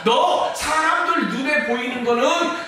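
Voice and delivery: loud, high scolding voice of Job's friends; accusing friends. Acted. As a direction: press him hard to confess, building to a shout on 토설해. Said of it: "친구들은 뭐라고 얘기합니까".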